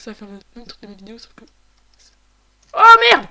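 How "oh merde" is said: hurlé très fort et aigu; cri de panique soudain; cri de surprise. Real, not acted. Surpris par l'ennemi. (juron hurlé de surprise)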